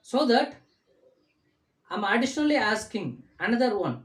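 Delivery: normal talking voice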